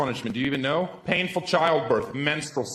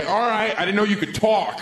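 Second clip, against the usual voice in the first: surprised; 6 semitones higher, 4 dB louder than usual. Retold or imitated. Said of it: "I'd be".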